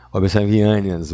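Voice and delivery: ordinary delivery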